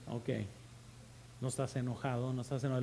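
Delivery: dry, muttered ok; doubting disbelief, quietly voiced; sarcastic parent. Sarcastic parent reply, much quieter. (padre incrédulo, en voz baja)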